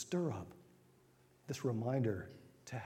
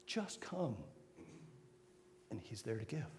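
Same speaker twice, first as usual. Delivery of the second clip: much softer, gentle invitation; hushed tender appeal to come; 3 semitones lower, 12 dB quieter than usual. Acted. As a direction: draw them in quietly, tenderly.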